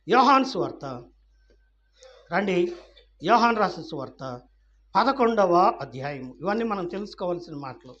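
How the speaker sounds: ordinary talk, nothing marked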